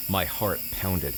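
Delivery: plain reading voice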